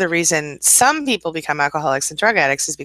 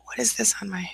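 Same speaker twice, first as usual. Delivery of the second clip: whispered to herself, puzzled; hushed murmur at the screen; 4 semitones higher, 8 dB quieter than usual. Real, not acted. Host murmuring at a screen light, whispered.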